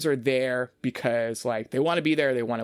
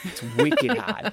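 broad Boston accent, building higher and drawn out; 8 semitones higher, slower than usual. Shown as a demonstration. Host mimicking Bostonian contestants, broad Boston accent. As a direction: ham up the Boston accent more on the repeat. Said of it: "I don't do a good Boston accent".

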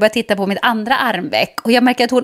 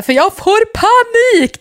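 higher, slow panicked plea; panicked cry; 11 semitones higher, 4 dB louder, slower than usual. Retold or imitated. Begging the nurse to pull the needle out, panicked. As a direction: beg in rising panic. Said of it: "skrek jag bara så här".

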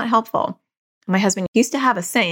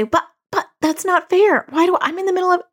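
high, slowed imitation of a complaining daughter; mock the kid's whiny pushback, pitched up; whiny protesting kid; 12 semitones higher than usual. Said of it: "She won't say".

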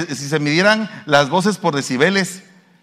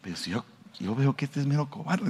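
cautious muttering; 11 dB quieter than usual. Retold or imitated. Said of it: "lo está razonando".

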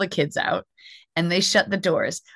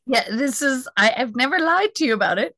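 mock-defend yourself, rising higher and higher; exasperated protest; 7 semitones higher than usual. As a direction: pitch climbing to a high, incredulous squeal. Acts out protesting to upset clients.

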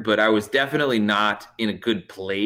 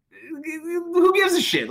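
high, slow, stretched-out disbelief; exasperated retort; much slower than usual. Retold or imitated. Fed-up driver answering the stalling cop, exasperated. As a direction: imitate a fed-up driver, pitched way up.

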